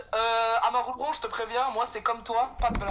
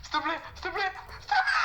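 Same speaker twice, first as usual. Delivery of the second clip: panicked begging; 10 semitones higher than usual. Real, not acted. About to die, begging.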